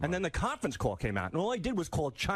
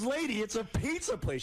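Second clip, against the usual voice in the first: higher-pitched, exasperated quote; retold exasperation; 6 semitones higher than usual. Pizza man to a confused woman, mock exasperated. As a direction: imitate a man losing patience.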